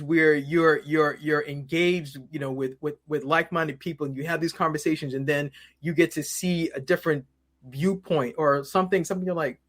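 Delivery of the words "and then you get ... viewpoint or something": ordinary talk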